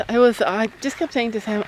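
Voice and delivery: ordinary talk, nothing marked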